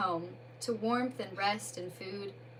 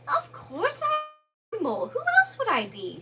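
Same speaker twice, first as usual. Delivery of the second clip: irritable; 12 semitones higher, 6 dB louder, slower than usual. Acted. Mole voice.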